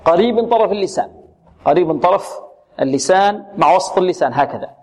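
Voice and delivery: ordinary delivery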